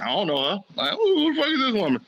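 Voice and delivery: a hint of a husband feigning he doesn't know her; mock denial. Husband disowning wife, acted.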